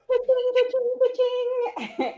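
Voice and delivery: high, drawn-out ka-ching sound; cash-register ka-ching. Acted. Player voicing the greedy salesman's delight, high sing-out ka-ching.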